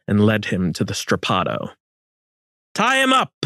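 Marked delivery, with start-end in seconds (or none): 2.7-3.3 shouted order. A narrator's reading. Gonzalo shouting at his men to tie the Inca. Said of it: "Gonzalo shouted".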